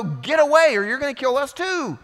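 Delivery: high, loud cry to get away; frightened crowd yelling. Retold or imitated. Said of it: "they all go".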